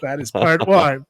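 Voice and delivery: ordinary talk, nothing marked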